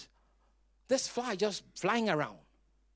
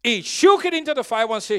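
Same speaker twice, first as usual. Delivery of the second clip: preaching at full force; 7 semitones higher, 12 dB louder than usual. Acted. Preacher leading congregation response.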